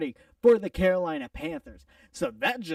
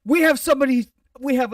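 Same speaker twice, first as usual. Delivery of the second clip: excited announcement; 6 semitones higher, 7 dB louder, faster than usual. Real, not acted.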